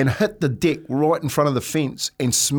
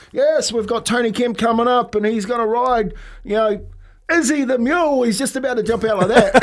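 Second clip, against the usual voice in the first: much higher, hyped-up announcer call; rodeo announcer; 8 semitones higher than usual. Retold or imitated. Player retelling the rodeo announcer introducing him on the mule. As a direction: sell the crowd on the next rider.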